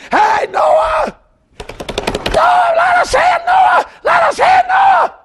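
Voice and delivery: very high, desperate screamed plea; screaming. Retold or imitated. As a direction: scream as the drowning crowd begging to get in. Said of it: "And they're screaming".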